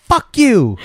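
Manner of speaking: high, loud mock cursing; pissed-off woman. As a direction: imitate her angry outburst. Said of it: "she just kinda keeps repeating herself".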